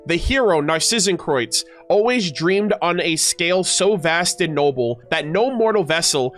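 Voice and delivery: deliberate, dramatic heroic reading; epic legend narration. Acted. Streamer reads the hero's quest to chat, epic narration. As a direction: build it like a grand legend.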